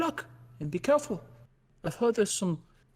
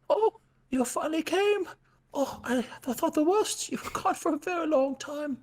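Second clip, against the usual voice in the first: put-on higher, lilting honey-loving old bear; bear NPC voice; 7 semitones higher than usual. Game master voicing Winnie greeting the crew, in a higher bear voice. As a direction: coo over the honey like a dreamy bear.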